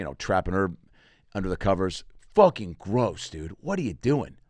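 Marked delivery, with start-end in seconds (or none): none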